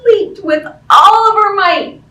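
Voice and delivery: loud, high burst on the leap; big leap. A narrator's reading. Teacher reads the kitten's big leap, loud and high. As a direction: launch the line like a jump. Climax of the story.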